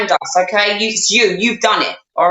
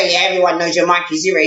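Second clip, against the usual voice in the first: low, unimpressed aside; dry dismissal; 8 semitones lower, faster than usual. Real, not acted. Answering the scammer's boast, dry dismissal. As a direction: drop the act and scoff in a low voice.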